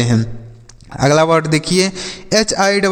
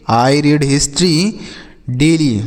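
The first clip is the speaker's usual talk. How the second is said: slow demo; much slower than usual. Shown as a demonstration. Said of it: "मैं प्रतिदिन इतिहास पढ़ता हूँ".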